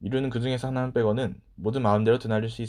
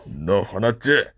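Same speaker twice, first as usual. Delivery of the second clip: slow, drawn-out taunting question; teasing; 3 semitones lower, 6 dB louder, much slower than usual. A narrator's reading. Master taunting the milk-soaked boy, slow and teasing. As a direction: needle him, savoring each word. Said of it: "약 올리듯 말했죠".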